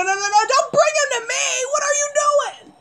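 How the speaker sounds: panicked screaming. Real, not acted. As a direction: shriek at them in rising panic. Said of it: "screaming the way I am".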